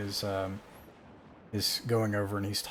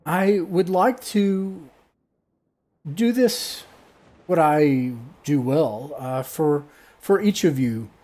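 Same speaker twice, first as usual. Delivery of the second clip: high, loud, slow grand voice; fairy godmother; 6 semitones higher, 7 dB louder, slower than usual. Acted. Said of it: "a fairy godmother".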